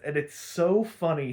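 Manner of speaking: ordinary talk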